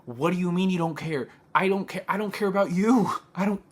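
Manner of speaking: heated outburst, really fuming; worked up. Real, not acted. She vents at the officer who didn't care, furious. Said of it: "I can get worked up about it".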